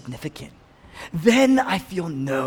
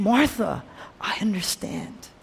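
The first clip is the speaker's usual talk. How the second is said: higher, hushed tenderness falling toward a whisper; gentle counseling; 5 semitones higher, 3 dB quieter, slower than usual. Acted. Jesus counseling Martha, gentle and hushed. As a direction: soothe softly, slowing at the end. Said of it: "He's counseling her".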